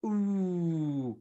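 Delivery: soft rounded ooh; teacher modelling the vowel ooh; ooh demo. Shown as a demonstration. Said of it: "The next one is".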